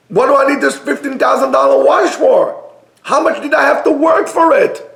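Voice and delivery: high-pitched, exasperated rhetorical questions; incredulous questioning. Real, not acted. Preacher questioning the cost of a luxury watch, incredulous. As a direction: raise the pitch and demand a reason.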